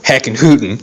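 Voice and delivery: exaggerated Southern cowboy twang; southern accent. Shown as a demonstration. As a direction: show off a hammy Southern accent. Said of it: "You gotta sound like this".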